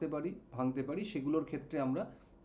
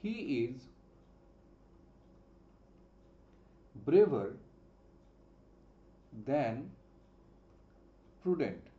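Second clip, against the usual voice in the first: slow dictation; 3 semitones lower, much slower than usual. Shown as a demonstration. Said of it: "আমি যদি বলি".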